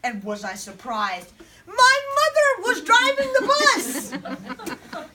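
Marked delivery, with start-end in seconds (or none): 1.7-5.1 very high, loud, slow cry of disbelief; shocked exclamation. Acted. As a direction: shriek the discovery, stretching it out. Shocked discovery of driver.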